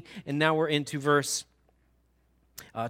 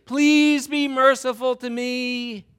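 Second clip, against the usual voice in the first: slow, higher begging; pleading servant; 5 semitones higher, 5 dB louder, much slower than usual. Pretend. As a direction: draw out the plea for mercy. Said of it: "He's pleading for mercy".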